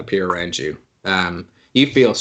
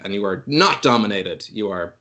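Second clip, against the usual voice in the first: usual speaking voice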